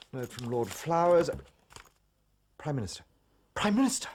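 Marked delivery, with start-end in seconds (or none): 3.5-4.1 louder, higher second call; urgent call. Acted. Secretary calling the Prime Minister again, loud and urgent. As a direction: call him sharply over the room.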